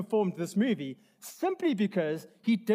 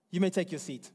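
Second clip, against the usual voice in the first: usual speaking voice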